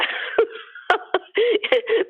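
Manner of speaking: breathy high laugh. Real, not acted. Laughs about her voice.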